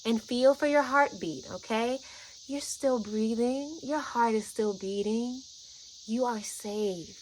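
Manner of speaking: slow, tender reassurance; speaking love. Retold or imitated. Guide telling a frightened friend she's safe, slow loving tone. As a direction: soothe them slowly, phrase by phrase. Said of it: "I'm speaking in this tone".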